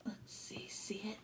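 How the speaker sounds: hushed whisper. Real, not acted. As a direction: lean in and breathe it quietly. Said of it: "peeking aside to children".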